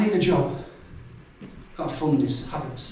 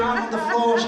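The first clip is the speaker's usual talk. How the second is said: ordinary talk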